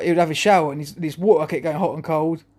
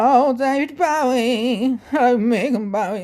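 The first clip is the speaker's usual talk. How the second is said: higher, very drawn-out singer caricature; 8 semitones higher, much slower than usual. Acted. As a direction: stretch the words out slowly, pitched up. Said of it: "[name]. I don't do [name]".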